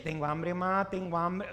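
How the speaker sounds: put-on insistent kid chant over mom; nagging children. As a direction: keep pestering without a break. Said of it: "los hijos empiezan".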